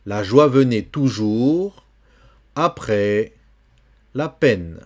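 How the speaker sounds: slow "La joie venait toujours après la peine", T of "venait" silent; careful model reading. Shown as a demonstration. Teacher modelling the verse for a student, slow careful diction. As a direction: read "venait" as "vené", phrase by phrase. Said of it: "le T final ne se prononce pas".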